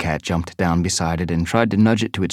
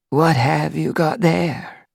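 higher, slow, questioning queen cat; she-cat queen voice; 9 semitones higher, slower than usual. Acted. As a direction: ask gently as the nursery queen.

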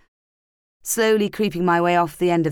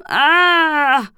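loud high wailing cry; anguished wail; 10 semitones higher, 7 dB louder than usual. Acted. Narrator realising she slept with Nicholas twice, wailing. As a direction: wail in mortified despair.

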